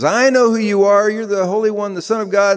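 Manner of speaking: high-pitched outcry of the man in the synagogue; man crying out. Acted. Man in the synagogue confronts Jesus, crying out high. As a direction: call it out high, in one rising-voiced rush.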